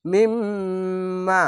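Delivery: মিম্মা with the doubled m hummed long through the nose; slow tajweed chant; nasal ghunna. Shown as a demonstration. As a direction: hold the nasal hum on মিম্মা, then stretch the a. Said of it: "ওয়াজিব গুন্না ... আলিফ টেনে পড়বেন".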